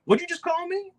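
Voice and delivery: high, sharp indignant question; like she can't believe what she just heard. Retold or imitated. Wife retorts on overhearing insult.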